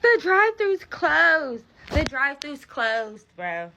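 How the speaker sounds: high, loud and drawn out, dropping back on the repeat; exasperated disbelief. Real, not acted. To her viewers about the closed pharmacy, exasperated disbelief. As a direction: stretch out the bad news, too fed up to believe it.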